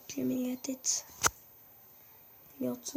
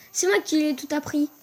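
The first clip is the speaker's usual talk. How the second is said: gloating; 5 semitones higher, 9 dB louder than usual. Real, not acted. Gloating win.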